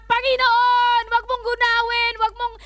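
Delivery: loud, high plea for mercy; crying out to God. Acted. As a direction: beg God at full voice, as a whole city would.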